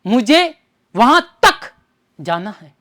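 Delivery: emphatic तक set off by pauses; stress on तक. Shown as a demonstration. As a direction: hit तक hard, pause, then drop away. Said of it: "अतिरिक्त जोर दिया मैंने".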